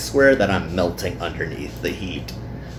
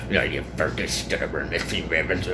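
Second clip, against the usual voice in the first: softer, under-the-breath grumble; muttered curse; 3 semitones lower, 6 dB quieter than usual. Acted. Sweaty pool worker cursing the manager, a soft mutter. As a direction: swear quietly so nobody hears. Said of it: "I suppress the urge to mutter to myself".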